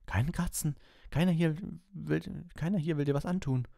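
put-on high boy's voice calming a pet; soothing boy. A narrator's reading. Ron calms his squirming rat, soothing. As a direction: gently hush a frightened rat.

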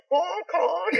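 high honk through laughter. Shown as a demonstration. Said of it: "One more, one more time".

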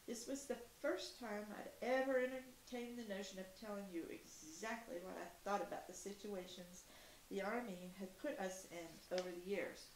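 plain reading voice

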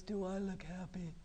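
soft, low, glum question; slumped, unhappy. Shown as a demonstration. Teacher shows children a slumped posture, soft and glum. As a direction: ask it deflated, as if slouching. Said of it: "if I'm sitting like this".

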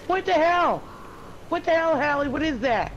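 high-pitched, alarmed questioning; startled alarm. Real, not acted. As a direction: demand answers in a sudden fright. Startled by warning of a ghost.

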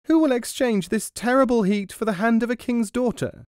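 high desperate mouse cry. Acted. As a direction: call out up high in panic. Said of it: "and cried out".

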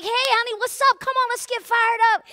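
rally him like a cheerleader; hyped-up cheering. Retold or imitated. Wife cheering her husband on as he tees off, excitedly. Hyping husband before his swing.

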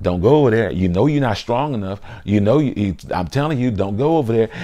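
soft, low inner warning; still small voice. Acted. Conscience warning someone off a temptation, still small voice. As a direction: quietly urge from the back of the head. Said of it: "hear that still small voice saying".

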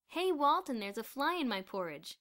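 loud mocking schoolboy; jeering classmate. A narrator's reading. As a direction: taunt the busboy loudly.

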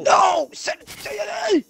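high, loud frantic yell; desperate shout. Real, not acted. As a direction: yell it out in panic. Desperate shouting during a fight.

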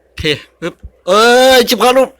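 real startled outcry; startled yell. Real, not acted. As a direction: blurt it out as the character drops. Character suddenly dies in game.

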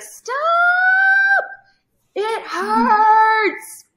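imitating a topped-out scream; imitating a high-pitched scream. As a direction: cry it out way up high like a child in pain. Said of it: "but really high-pitched".

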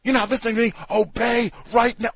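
high, loud imitation of an imperious teacher; mock domineering scolding. Host imitating the aggressive lady commanding children, mock furious. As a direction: order a child to obey, hammed-up. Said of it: "the lady that comes in with the accent and everything".

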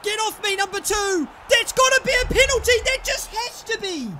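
high, loud indignant yell; outraged penalty appeal. Real, not acted. Player yelling that it must be a penalty, outraged. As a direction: scream for the penalty.

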